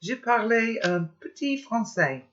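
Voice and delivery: bright, high French greeting; a playful opening in French. Shown as a demonstration. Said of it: "I thought I'd do that in French".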